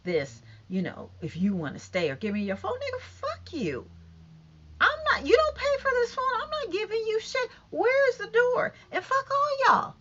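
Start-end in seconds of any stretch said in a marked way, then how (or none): 2.7-9.9 high, cussing out an imagined boss; angry telling off. Pretend. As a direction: storm out cussing them off, pitched high. Imagined clash, telling someone off.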